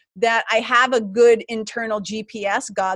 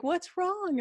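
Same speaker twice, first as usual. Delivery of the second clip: concerned coach; 9 semitones higher, 9 dB quieter than usual. Retold or imitated. Coach reacts to her tears.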